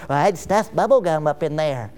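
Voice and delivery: a hint of a kid offering a silly fix; elementary student. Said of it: "Let's go interview elementary students".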